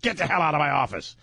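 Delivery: high, yelling station boss; gruff radio boss. Retold or imitated. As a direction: bark orders like an angry program director. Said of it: "That's the radio I remember".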